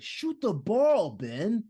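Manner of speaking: high, loud mock scream, drawn out; imitated scream. Podcaster imitating a player screaming at his teammate to shoot, high drawn-out yell. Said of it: "Kyrie screaming".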